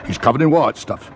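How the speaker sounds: a hint of a London cabbie; taxi driver. A narrator's reading. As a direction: say it as a doubtful cab driver. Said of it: "asked the driver".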